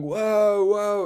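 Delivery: high, overexcited mock gasp; mock amazed whoa. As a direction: ham up his amazement. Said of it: "D C going".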